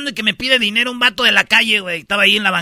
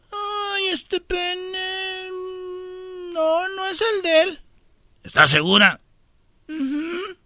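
tearful widow; 11 semitones higher, slower than usual. Retold or imitated. Widow shown the remains denies it's her husband, high teary voice.

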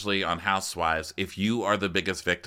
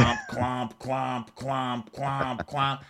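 mock footsteps; 5 semitones higher, much slower than usual. Imitating Caroline walking in.

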